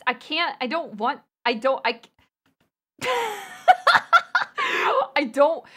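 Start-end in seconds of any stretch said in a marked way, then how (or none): 3.0-5.1 high, loud burst of laughter; helpless laughter. Real, not acted. Refusing chat's voice request, high helpless laughing fit. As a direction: crack up at the very idea.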